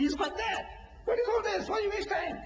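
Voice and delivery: very high-pitched mocking protest; mock dismissiveness. Preacher acting a believer who shrugs off religious effort, high mock dismissal.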